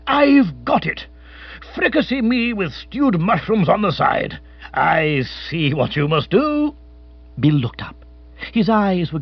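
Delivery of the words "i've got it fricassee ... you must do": higher, slow, blustering colonial captain; a pompous old adventurer struck by an idea; lightly voiced hearty captain; excitable old captain. A narrator's reading. Captain Bigger's voice in story.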